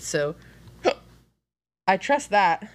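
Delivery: hiccup catching the words. Real, not acted. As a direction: talk through a hiccup. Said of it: "I have hiccups".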